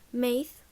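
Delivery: "mouth" said like mayth; Irish mouth as "meith". Shown as a demonstration. Teacher showing Irish mouth, ay vowel. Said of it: "mouth can sound a bit like".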